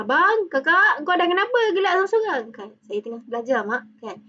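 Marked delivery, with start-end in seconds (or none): 0.0-2.4 higher-pitched, hurried parent voice; mock parental nagging, pitched up; puzzled parent calling out. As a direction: imitate a parent questioning the kids. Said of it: "Your parents tanya".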